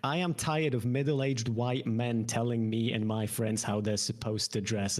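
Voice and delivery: usual speaking voice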